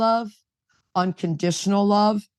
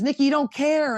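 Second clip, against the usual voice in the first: mock complaint; 7 semitones higher than usual. Retells her ex's accusing complaint.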